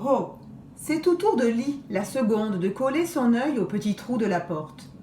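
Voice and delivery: usual speaking voice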